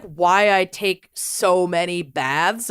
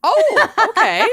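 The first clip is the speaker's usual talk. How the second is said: laughing; 12 semitones higher, 4 dB louder, slower than usual. Real, not acted. Laughing reaction to odd confession.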